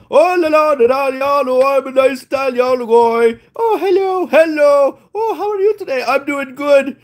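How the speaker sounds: high, sing-song Italian guy; hammed-up Italian guy greeting. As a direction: play a cheery Italian charmer. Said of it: "this Italian guy comes up".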